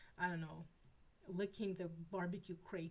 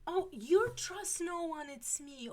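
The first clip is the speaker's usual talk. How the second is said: surprised recognition; 9 semitones higher, 10 dB louder, slower than usual. Real, not acted. Recognizes a chat user, surprised.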